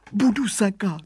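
high whimpered promise; whining. A narrator's reading. Hugo promises the father to behave, whining. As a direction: whine like a scolded boy. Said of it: "sliboval výrostek kňučivým hlasem".

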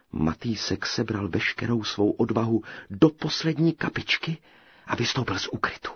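ordinary delivery

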